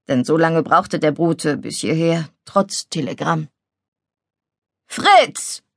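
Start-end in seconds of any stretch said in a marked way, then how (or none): 4.9-5.6 high, falling shout of a name; startled, scolding cry to a boy; indignant call. Acted. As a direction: call out sharply in outrage. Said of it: "rief plötzlich die empörte Leni".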